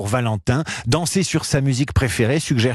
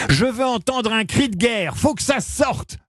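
coach's order; 8 semitones higher than usual. Retold or imitated. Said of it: "lui ordonne sa coach".

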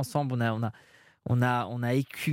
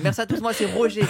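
mock stage sign-off; 6 semitones higher, 4 dB louder than usual. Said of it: "J'ai dit".

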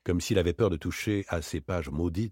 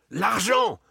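high, loud furious cry; furious demand; 13 semitones higher, 5 dB louder than usual. Acted. Varin, knife in hand, yells at Daspry for the money. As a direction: hurl the word at him in rage.

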